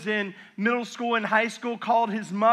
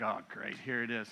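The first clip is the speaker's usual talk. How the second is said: low, much softer groan of resignation; mumbled, much softer "oh great"; resigned teen; 10 semitones lower, 13 dB quieter than usual. Retold or imitated. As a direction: sag into dread. Teen's resigned reaction.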